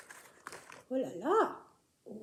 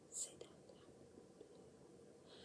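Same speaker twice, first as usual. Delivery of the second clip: whispering; 29 dB quieter, slower than usual. Acted. Whispered answer.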